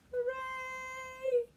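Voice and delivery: high happy hooray; excited cheer. Acted. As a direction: cheer the win. Celebrating end of hiccups.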